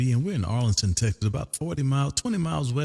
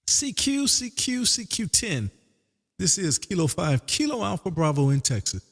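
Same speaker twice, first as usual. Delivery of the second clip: radio CQ call; 3 semitones higher than usual. Acted. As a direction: call out for any station, steady and clear. Calling CQ again.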